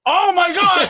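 real high, loud outburst; shocked realization. Real, not acted. Host realizing who friend means, shocked outburst.

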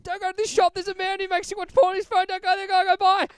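high, loud panicked babble; frantic warning. Retold or imitated. Frantic warning to tourist couple.

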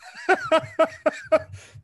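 loud high laugh; high burst of laughter. Real, not acted. Mom's here joke.

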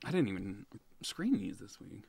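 hushed confession to the co-host; whispered aside. Real, not acted. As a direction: whisper it like a secret. Confessing aside while reading listener email.

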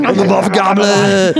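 high, slow, grand self-introduction; dramatic announcer voice. Pretend. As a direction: announce it like a movie villain. Proclaims himself the Muff Gobbler.

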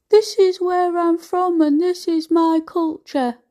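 mocking ex's voice. Throwing her ex's culture line back at him in mock voice.

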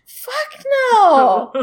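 high, loud outburst of refusal; emphatic mock refusal. Son rejecting the inherited mermaid, emphatic mock refusal. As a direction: belt it out as an indignant heir.

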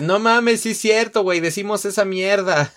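high, loud amazed disbelief; excited Mexican reaction. Retold or imitated. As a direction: blurt it out like a delighted Mexican friend.

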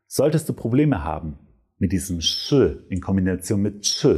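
normal talking voice

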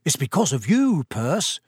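deadpan earnest explanation; mock serious. A narrator's reading. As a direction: tease him with a straight delivery. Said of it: "said George seriously".

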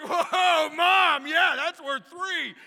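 high, loud, slow cheering whoa; excited boys. Retold or imitated. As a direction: cheer on mom like a scorekeeper. Boys cheering mom's comeback.

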